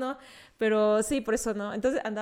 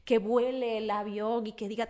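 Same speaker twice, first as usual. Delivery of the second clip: ordinary talk, nothing marked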